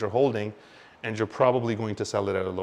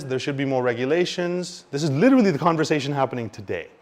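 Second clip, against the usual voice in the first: usual speaking voice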